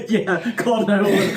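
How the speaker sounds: laughing. Retold or imitated. Laughing while repeating wife.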